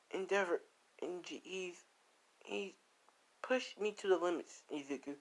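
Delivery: high, soft imitation of tearful complaint; crying. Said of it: "Ray's crying".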